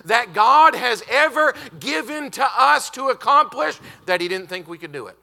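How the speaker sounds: loud, high, urgent sermon; preaching at full force. Acted. Preacher exhorting his congregation loudly, at full force. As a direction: drive it home to the pews.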